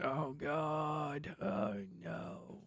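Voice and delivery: ordinary talk, nothing marked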